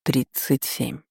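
quiet, drawn-out, tight-jawed answer; through clenched teeth. A narrator's reading. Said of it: "процедил сквозь зубы".

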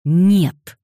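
loud, abrupt refusal; angry one-word shout; barking. A narrator's reading. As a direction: bark it out sharply. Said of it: "рявкнул Дэниел".

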